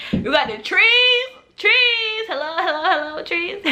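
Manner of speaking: high-pitched, hammed-up pestering; playful squealed plea, breaking into laughter; eager calling out. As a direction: call out for it in a silly high voice. Retells eagerly asking vendor for weed.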